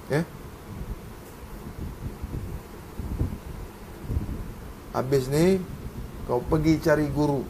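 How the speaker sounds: low, soft, measured exposition of doctrine; quiet teaching. Real, not acted. Teacher explaining doctrine to students, low and hushed. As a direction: lay it out softly and steadily, like a creed.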